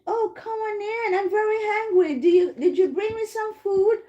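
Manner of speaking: high-pitched, welcoming storybook grandma; happy grandma. Acted. Grandma welcomes her visitor at the door, happy and high. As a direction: act a happy granny letting a visitor in. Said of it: "the grandma was so happy".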